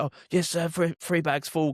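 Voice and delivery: mock servile. Cabbie parodying how he humours a demanding fare, mock-servile. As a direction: lay the obedience on thick. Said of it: "in a real innocent way".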